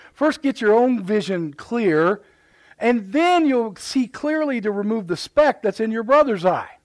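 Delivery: slow and loud, leaning hard on "First" with a rising tune; slow, emphatic preaching. Acted. Preacher quoting Jesus on the plank to the church, slow and loud. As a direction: drive each word home from the pulpit.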